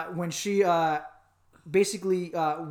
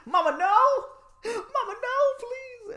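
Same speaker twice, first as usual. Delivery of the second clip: high, whiny begging cry; hammed-up plea to mom; mock pleading; 3 dB louder, slower than usual. Son begging mom to keep paying, high mock wail. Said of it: "I'm like".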